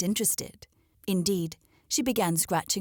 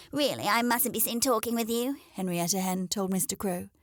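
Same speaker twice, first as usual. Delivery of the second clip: haughty hen; 4 semitones higher than usual. Acted. Hen brushes off crow.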